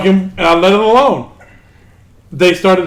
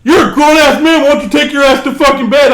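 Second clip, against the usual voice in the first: mock taunt; 8 semitones higher, 4 dB louder than usual. Teens mocking him in party.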